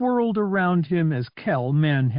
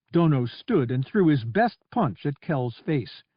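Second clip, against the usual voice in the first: ordinary delivery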